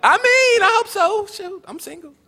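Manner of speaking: high, giddy woman's voice with a laugh; eager single woman. Retold or imitated. Woman in the audience swooning over the imam, high and giggly. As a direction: play the girl hoping to marry him, laughing. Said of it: "she's like".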